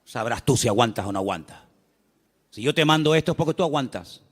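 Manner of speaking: calm deep scolding from above; stern Lord. Retold or imitated. The Lord answering the complaining believer, low stern rebuke. Y el Señor dice.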